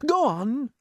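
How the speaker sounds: high, eager prompt; growing interested. A narrator's reading. Others urging James to continue, growing interested. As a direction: urge him on with rising interest. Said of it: "beginning to grow interested".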